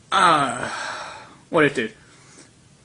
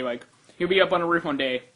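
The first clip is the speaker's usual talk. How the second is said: usual speaking voice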